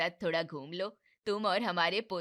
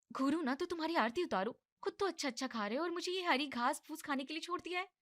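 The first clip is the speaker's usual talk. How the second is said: higher, fast annoyed rant; irritated young wife; 9 semitones higher, 3 dB quieter, faster than usual. Acted. Wife rants at husband over her food, higher and fast. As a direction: fire it off in a huff.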